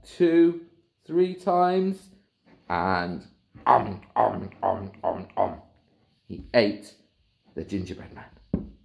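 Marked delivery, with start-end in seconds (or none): 3.7-5.6 munching noises. Acted. Hippo gulps him.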